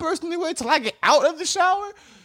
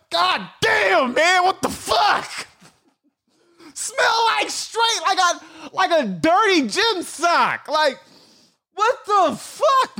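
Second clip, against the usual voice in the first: disgusted shout; 6 semitones higher than usual. Real, not acted. Smelling his own armpits, he shouts in disgust. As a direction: yell in horror at the stink.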